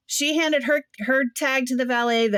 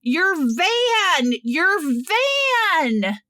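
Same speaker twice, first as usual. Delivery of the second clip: mock insistent stranger; 7 semitones higher, much slower than usual. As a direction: raise the voice so she can hear. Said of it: "the lady goes".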